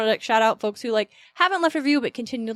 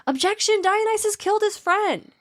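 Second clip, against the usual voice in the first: mock-yell like an eager child heckler; yelling kid; 11 semitones higher than usual. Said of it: "the kid just ended up yelling".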